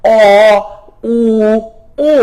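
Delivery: অ, উ, ও held separately with pauses; distinct "aw, oo, o" for learners to copy; vowel demonstration. Shown as a demonstration. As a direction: round the lips for অ, উ, ও. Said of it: "তিনটা ধ্বনি আমি বললাম".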